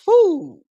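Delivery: high, loud "whew"; exclaimed whew. Retold or imitated. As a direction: re-enact a loud whew at the game. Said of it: "I be like".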